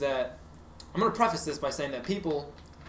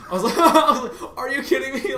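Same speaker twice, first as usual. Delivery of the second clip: high, loud, cracking up while acting incredulous; laughing disbelief; 9 semitones higher, 8 dB louder than usual. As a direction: retell it through helpless laughter. Cracking up, retelling his disbelief.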